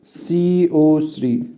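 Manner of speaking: سي او ثري said very slowly, letter by letter; teacher dictating a formula, very slow; slow dictation. Shown as a demonstration. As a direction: spell it out slowly for note-taking. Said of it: "يبقى اقول له".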